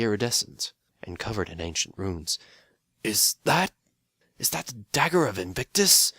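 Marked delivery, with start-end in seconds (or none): 3.0-6.1 awed gasp. Acted. Old pony gasps at the tools.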